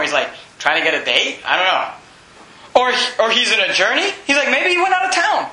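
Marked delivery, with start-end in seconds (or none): 4.5-5.5 high-pitched teasing suggestion; playful jeer, pitched up; mocking. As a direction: offer a taunting mock excuse. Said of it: "Elijah mocked them".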